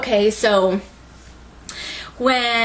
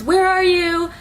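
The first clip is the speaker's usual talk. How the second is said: high-pitched call for missing friends; radio call; 9 semitones higher, 3 dB louder than usual. Retold or imitated. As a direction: imitate calling out over a radio. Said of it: "trying to radio her friends".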